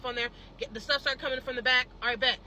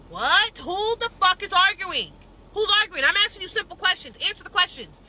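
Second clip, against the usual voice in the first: louder, higher, indignant; angry retort; 6 semitones higher, 5 dB louder than usual. Retold or imitated. Snapping back at the manager who keeps saying he won't argue. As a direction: demand answers, fed up.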